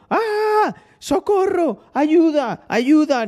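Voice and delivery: high-pitched screaming for help, imitating the girls; mock panicked screams. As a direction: shriek it like a terrified girl. (gritos agudos de pánico imitados)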